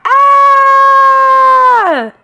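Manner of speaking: long, high, loud scream; startled yell. A narrator's reading. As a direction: scream in fright, held long. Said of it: "His father turned around and yelled".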